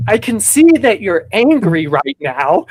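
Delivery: slow, syrupy therapist-parent voice; hamming up the overly gentle parent; mock gentle parent. Mimicking the hippie parents calmly naming his anger.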